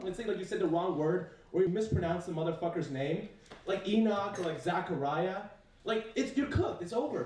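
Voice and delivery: ordinary talk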